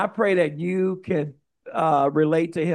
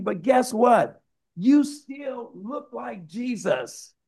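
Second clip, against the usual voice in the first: raised pitch, emphatic challenge; insistent accusation; 6 semitones higher than usual. Acted. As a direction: call him out, drawing out the key words. Acting out accusers confronting Peter.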